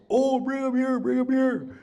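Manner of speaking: high, urgent bring 'em here; eager demand. Retold or imitated. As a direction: beg for them high and fast. Eager blind Wysaki-chan.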